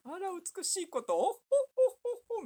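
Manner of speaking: high haughty madam laugh; rich madam voice. Retold or imitated. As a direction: cackle like a snooty rich lady. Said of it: "みたい な".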